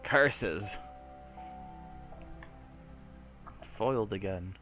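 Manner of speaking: cartoon villain grumble. Mock villain defeat.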